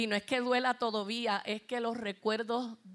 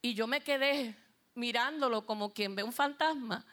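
ordinary talk

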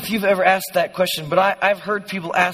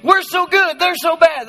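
high, louder mock boasting; mock self-righteous; 9 semitones higher, 5 dB louder than usual. Preacher mimicking us-against-them believers, high mock self-righteousness. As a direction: parody an us-against-them attitude. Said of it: "a us against them kind of a thing".

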